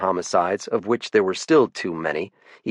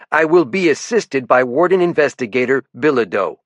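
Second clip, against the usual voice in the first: put-on sharp louder challenge in a light French-Canadian accent; lieutenant calling out a warden, faint French-Canadian lilt; a touch of French-Canadian accent, higher; 5 semitones higher, 4 dB louder than usual. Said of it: "His accent tended to make his T H's sound like D's".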